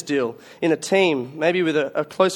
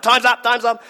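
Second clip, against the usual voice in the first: gleeful time's up; 7 semitones higher, 4 dB louder than usual. Retold or imitated. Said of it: "They're like".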